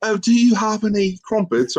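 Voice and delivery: high, mock posh British accent. Asking the waitress for crumpets in mock British accent. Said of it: "talk with a British accent".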